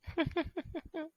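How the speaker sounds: a high little gossipy giggle; a friend giggling over a juicy secret. Acted. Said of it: "Dá aquela risadinha ali".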